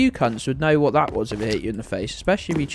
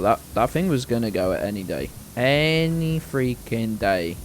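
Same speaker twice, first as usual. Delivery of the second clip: ordinary delivery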